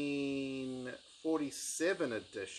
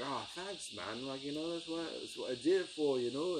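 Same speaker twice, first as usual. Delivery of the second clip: put-on a rough, half-hearted Scottish accent; bad Scottish accent; 3 semitones higher than usual. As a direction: try a Scottish voice and fail a bit. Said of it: "it's a terrible, I'll stop it now".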